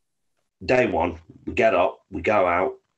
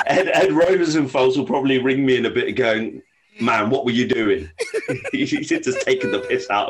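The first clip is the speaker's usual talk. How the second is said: laughing; 5 semitones higher than usual. Real, not acted. Laughing about friend's teasing.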